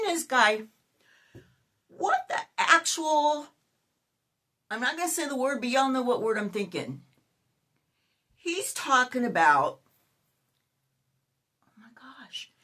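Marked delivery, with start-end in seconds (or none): 1.9-3.5 outraged disbelief. Real, not acted. Outraged at dating video. 11.7-12.5 breathy whispered oh my gosh; a stunned whisper to the camera; shocked whisper. Real, not acted.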